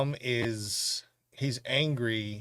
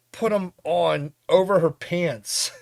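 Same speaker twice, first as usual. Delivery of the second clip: ordinary talk, nothing marked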